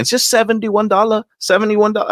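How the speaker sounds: high, exasperated repetition of "seventy-one dollars". Retold or imitated. Chris arguing the numbers with the salesman, exasperated and high. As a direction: pitch up in disbelief at the number.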